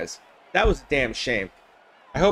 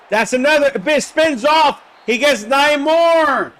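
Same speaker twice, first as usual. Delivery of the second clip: higher and louder, words stretched slowly; excited shout; 6 semitones higher, 3 dB louder than usual. Real, not acted. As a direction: cheer the run as it breaks free. Big run in the game.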